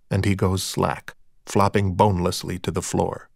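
plain reading voice

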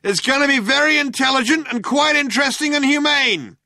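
high, loud, sustained shout; shouting cop. A narrator's reading. Cop delivering an ultimatum to the cornered group, shouting. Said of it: "shouted the cop".

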